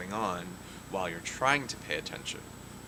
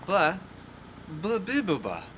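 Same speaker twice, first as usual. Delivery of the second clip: floppy jaw ya ya drill; 7 semitones higher, 4 dB louder, much slower than usual. Acted. Gibberish babble with voiceover translation.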